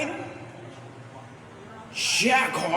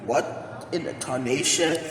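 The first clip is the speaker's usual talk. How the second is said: ordinary talk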